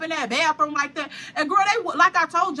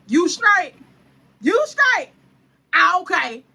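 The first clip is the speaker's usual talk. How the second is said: eager check-in; 4 semitones higher, 5 dB louder, slower than usual. Retold or imitated. Friend checking she's okay.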